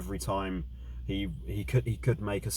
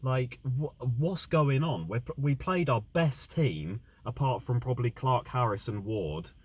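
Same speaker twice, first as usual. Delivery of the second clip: heated, raised fan rant; angry rant; 4 semitones higher than usual. Real, not acted. Fan ranting about team's loss.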